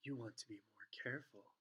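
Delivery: soft, hushed warning; Snape. A narrator's reading. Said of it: "said Snape".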